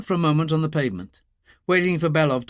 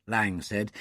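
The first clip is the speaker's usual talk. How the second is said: plain reading voice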